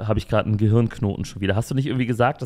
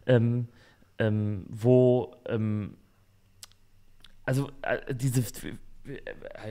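fading into silence; trailing off; 7 dB quieter, slower than usual. Real, not acted. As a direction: voice dropping away mid-thought. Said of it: "ich kann den Satz nicht zu Ende bringen".

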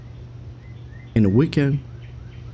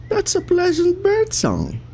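high sing-song; slower than usual. Real, not acted. Sudden relief, high voice.

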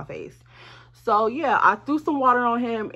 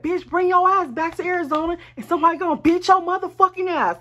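high, loud angry tirade; re-enacted furious rant, pitched way up; mad threat; 10 semitones higher, 6 dB louder than usual. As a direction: go all in on a heated threat. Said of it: "Like, I'm mad".

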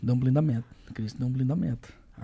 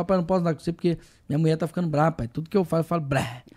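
fast, run-together quote; rushed retelling; faster than usual. Retold or imitated. Retelling a friend's complaint, fast and run-together. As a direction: rattle it off. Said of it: "Que ele falou".

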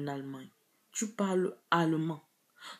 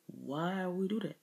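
quieter, falling question on "Why we do that"; 5 dB quieter than usual. Shown as a demonstration. Speaker recalling a sudden realisation, quiet falling "Why we do that". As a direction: ask "Why we do that" softly, dropping at the end.

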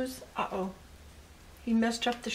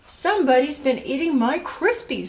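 lightly voiced storybook mother, higher and louder; a higher, mildly indignant mama's complaint; Missus Blair; 5 semitones higher, 5 dB louder than usual. A narrator's reading. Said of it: "said Missus Blair".

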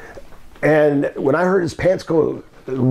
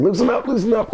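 mild pleading; 8 semitones higher than usual. Retold or imitated. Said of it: "Coco was saying".

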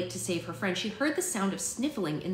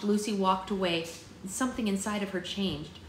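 usual speaking voice

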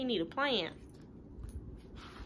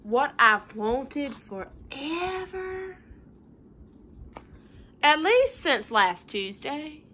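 pigeon building up; 4 semitones higher, 4 dB louder, slower than usual. Acted.

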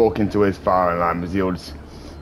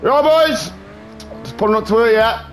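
higher, breezy matey greeting; chummy lad voice; 8 semitones higher than usual. Acted. Greeting the dock workers as a friendly fake employee. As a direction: play the cheery bloke who belongs here.

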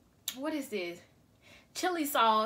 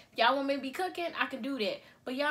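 ordinary delivery

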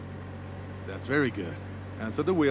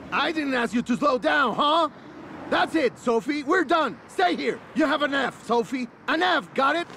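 angry shouting; 5 semitones higher than usual. Acted. Instructor failing student after she braked: angry shouting. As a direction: chew her out and fail her.

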